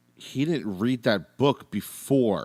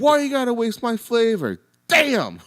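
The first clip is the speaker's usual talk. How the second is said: high, loud mock-gangsta shout; loud high imitation of a movie line; quoting Seth Green's line; 13 semitones higher, 7 dB louder than usual. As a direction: belt it like a wannabe rapper. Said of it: "And he goes".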